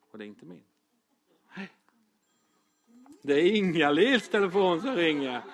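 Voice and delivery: ordinary talk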